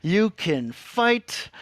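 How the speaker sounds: high, drawn-out heroic chant; Rufio impression. Quoting Rufio from Hook, high slow rallying voice. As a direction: stretch it out high like a rallying cry. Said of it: "then he was like".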